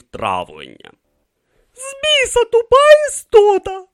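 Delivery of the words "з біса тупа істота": loud, high-pitched angry yell; cursing outburst. A narrator's reading. Tracy curses the stupid camel, yelling high and loud. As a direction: curse the beast at the top of your voice. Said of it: "буркнула б Трейсі".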